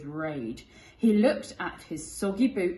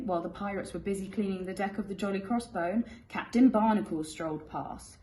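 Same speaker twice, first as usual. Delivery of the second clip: ordinary delivery, nothing marked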